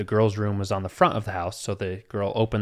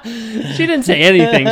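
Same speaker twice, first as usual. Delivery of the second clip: high, loud laughter through the words; real laughter breaking in; laughing; 13 semitones higher, 12 dB louder than usual. Real, not acted. Teller laughing while retelling the mom story.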